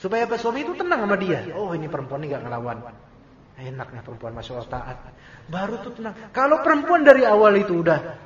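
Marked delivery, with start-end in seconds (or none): 1.5-5.0 low, soft musing to himself; quietly contented. Retold or imitated. Husband thinking about his obedient wife, quietly content. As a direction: voice a husband's hushed, pleased thought.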